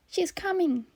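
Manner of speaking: put-on high, frightened child's hiss; hissed warning. A narrator's reading. Child warning host she's coming, hissed. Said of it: "he hissed".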